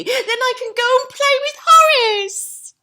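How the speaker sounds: high, loud excited piglet; excited squeal. Acted. Boris picking sticks, high excited squeal. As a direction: squeal it out with glee. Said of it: "Boris squealed excitedly".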